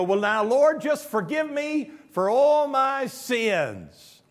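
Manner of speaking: high, loud, drawn-out plea to the Lord; mock generic prayer. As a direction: parody a blanket confession, stretching the last words.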